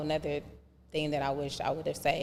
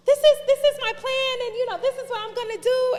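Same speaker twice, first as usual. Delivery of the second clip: much higher, eager and proud, hurried at the end; eager teen self; 12 dB louder than usual. Retold or imitated. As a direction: pitch it up high like a proud teenager laying out her plan. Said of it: "And I was like".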